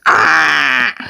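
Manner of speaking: a low, croaky caw like a crow; playful mock crow squawk. Shown as a demonstration. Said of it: "that actually is a crow voice again".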